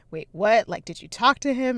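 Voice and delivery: excited disbelief. Retold or imitated. Friend pressing for details of celebrity sighting, loud and amazed. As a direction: jump on the news, demanding details. Retold eager reaction to sighting.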